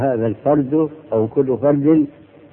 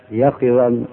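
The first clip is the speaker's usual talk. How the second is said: normal talking voice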